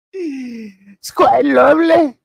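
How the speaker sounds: high, slow swooning drawl; Papa Tarly impression. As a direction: stretch out lovely in a high dreamy voice. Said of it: "That's my Papa Tarly impression".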